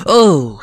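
loud, high "oh" falling away; real shocked outburst; startled cry. Real, not acted. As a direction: cry out in sudden alarm. Sudden danger in game.

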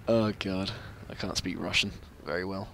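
ordinary delivery

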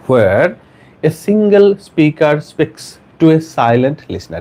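very slow, word-by-word reading; slow dictation. Shown as a demonstration. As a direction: spell out each phrase slowly for note-takers. Dictating, asks all to write. (ধীরে ধীরে একেকটি শব্দ পড়া)

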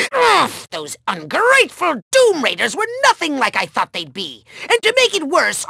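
ordinary talk, nothing marked

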